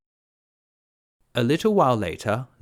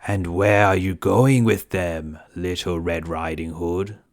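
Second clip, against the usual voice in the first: lower, louder, slow sly wolf; pry slowly like a scheming wolf; 3 semitones lower, 5 dB louder than usual. Acted. Wolf questioning the girl, low and sly. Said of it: "said the wolf".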